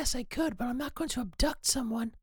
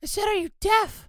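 louder, higher, exasperated repeat; speaking up; 5 semitones higher, 7 dB louder than usual. Acted. Sound engineer told to speak up, louder. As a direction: raise your voice to be heard. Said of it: "Speak up, [name]".

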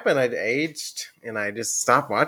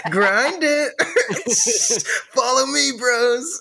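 skater bro voice; 10 semitones higher than usual. Pretend.

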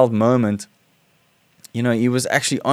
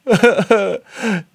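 fond laugh; 10 semitones higher than usual. Real, not acted. Laughs at child's line.

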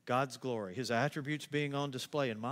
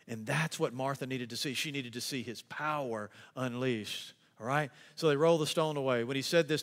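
normal talking voice

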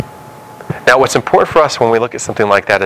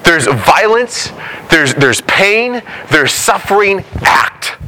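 pleading, climbing higher and slower; Habakkuk's complaint; 5 semitones higher than usual. Retold or imitated. Habakkuk pleading with God to act, voice climbing high. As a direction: cry out to God in exasperated complaint, rising higher line by line. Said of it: "So Habakkuk goes".

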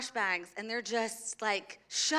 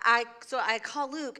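ordinary talk, nothing marked

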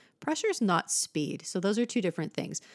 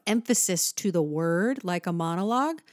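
ordinary delivery

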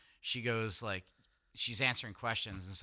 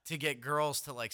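ordinary delivery

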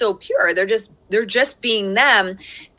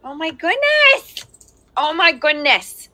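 startled exclaim; 7 semitones higher, 3 dB louder than usual. Real, not acted. Child messes with computer.

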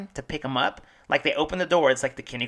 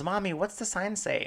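higher, lightly voiced little girl asking; 7 semitones higher, 4 dB quieter than usual. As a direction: imitate a small child asking her mom. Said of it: "the little girl goes".

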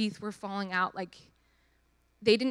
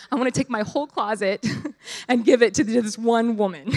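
laughing gush; 3 semitones higher, 8 dB louder than usual. Real, not acted. Gushing after emotional moment.

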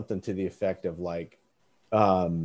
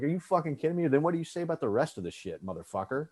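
higher, fast, mock disbelief that fades out; incredulous outrage; 6 semitones higher, 4 dB quieter, faster than usual. Retelling Raskin's comeback to the congressman, incredulous mock outrage. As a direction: blow up in disbelief at the spin, then trail off.

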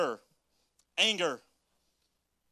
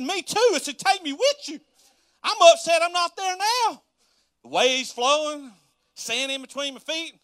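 mock whine; 10 semitones higher, 7 dB louder than usual. Whining to manager about beach.

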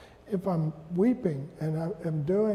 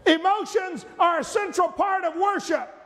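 loud, high proclamation; shouted pulpit declaration; preaching shout; 11 semitones higher, 4 dB louder than usual. Real, not acted. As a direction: drive the point home to the church.

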